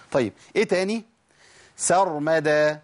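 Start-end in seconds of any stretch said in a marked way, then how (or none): 1.8-2.8 slow سرمدى with a thin, light س; light correct s. Shown as a demonstration. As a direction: keep the س in سرمدى light before the ر. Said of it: "الناس بتقول صرمدى".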